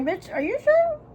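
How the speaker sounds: very high-pitched doubting question; high, skeptical reaction; incredulous. Retold or imitated. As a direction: squeak it out in mock disbelief. Retold disbelieving reply to sister.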